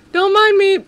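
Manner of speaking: loud teasing don't mind me; loud mock innocence. Mock-innocent game banter.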